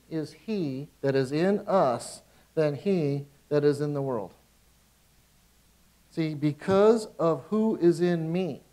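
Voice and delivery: loud, slow, emphatic proclamation; preaching declaration. Acted. Preacher to his congregation: loud, slow proclamation. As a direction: drive each word home to the church.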